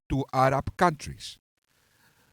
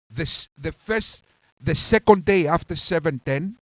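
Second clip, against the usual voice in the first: normal talking voice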